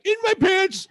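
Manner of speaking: high, shouted goofy voice; silly cartoon voice. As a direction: yelp it like a cartoon character. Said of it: "do that voice".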